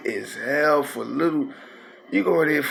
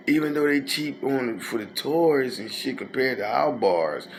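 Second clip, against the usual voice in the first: normal talking voice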